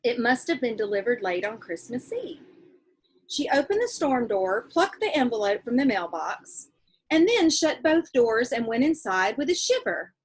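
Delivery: reading a letter from a novel to the class; steady read-aloud voice for kids. Acted. Teacher reading a letter in a novel to her class, read-aloud voice.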